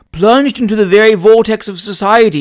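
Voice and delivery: affected sigh over society's burdens. A narrator's reading. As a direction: sigh it out like a world-weary dandy. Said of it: "said Mister John Smocker with a sigh".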